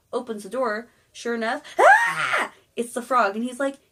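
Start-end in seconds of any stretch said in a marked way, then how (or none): 1.8-2.5 sudden high, loud scream; mock shriek. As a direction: shriek in comic shock at what's at the door. Princess shocked at frog at door.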